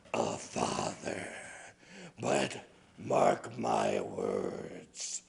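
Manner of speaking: a cold ghost leaving a warning; quiet menacing spirit. Acted. Snow woman's final warning.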